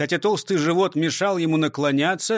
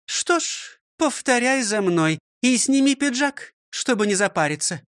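higher, scolding schoolmistress voice; stern old teacher; 7 semitones higher than usual. Acted. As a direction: lecture him like a strict teacher. Teacher gives orders. (строгая учительница)

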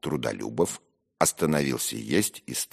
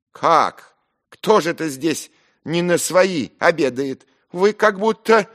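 very high, loud outraged challenge; fly at him in a rage; frenzied, offended shouting; furious; 10 dB louder than usual. Acted. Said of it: "и со стервенением смотря мне в глаза".

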